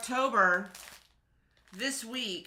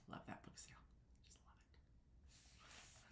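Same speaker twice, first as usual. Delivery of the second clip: breathy whispered aside; whispering; 28 dB quieter, much faster than usual. Real, not acted. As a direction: whisper it like a secret. Unvoiced aside about book sale.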